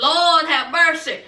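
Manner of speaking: usual speaking voice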